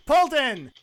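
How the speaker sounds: high, loud name call. Real, not acted. Host moves the game to a co-host, loud call. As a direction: call the next player up loudly. Host calls on next contestant.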